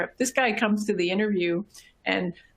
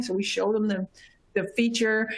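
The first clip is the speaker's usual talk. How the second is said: ordinary talk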